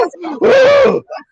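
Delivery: high, loud gasp for air; winded gasp. Retold or imitated. Hiking up the mountain, loud winded gasp. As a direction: heave in air after the climb. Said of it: "high out of breath".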